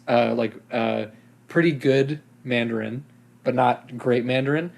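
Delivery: ordinary talk, nothing marked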